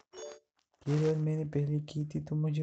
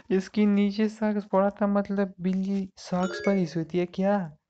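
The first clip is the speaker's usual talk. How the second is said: laugh, then ask through the giggles, higher and louder; real amused surprise, voice up and loud; cracking up at a silly find; laughing in disbelief; 4 semitones higher, 5 dB louder than usual. Real, not acted. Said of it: "मुझे हंसी आ रही है".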